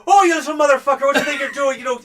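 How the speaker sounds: loud, high Tabalan swearing; thick Tabalan accent. Acted. Chrysitha cursing at the orc in her thick Tabalan accent. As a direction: curse him out in a heavy Tabalan accent. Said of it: "thick Tabalan accent".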